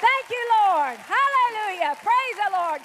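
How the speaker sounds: loud, high shouts of praise; jubilant praise. Real, not acted. Pastor celebrating someone's healing with the congregation, jubilant. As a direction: exult at full voice, stretching the hallelujahs.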